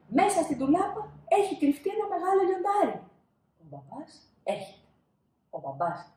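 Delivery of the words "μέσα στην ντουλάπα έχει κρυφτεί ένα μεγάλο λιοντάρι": high, loud frightened voice; a boy sure a lion is there; scared child. Acted. Child fears lion in wardrobe. (ψηλή φοβισμένη παιδική φωνή)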